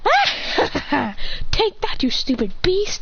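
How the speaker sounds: laughing high battle taunt; cackle, then crow at the beast; high gloating cry; triumphant taunt. Acted. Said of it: "She goes".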